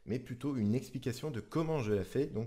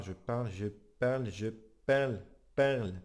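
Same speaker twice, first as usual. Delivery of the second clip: building nasal tone, louder at the end; growing nasal twang; speaking through the nose; 4 dB louder than usual. Shown as a demonstration. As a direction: push the words up into the nose. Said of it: "parler très légèrement du nez".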